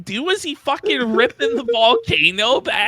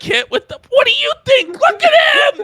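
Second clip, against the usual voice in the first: laughing disbelief; 10 semitones higher than usual. Real, not acted. Laughing incredulous outburst.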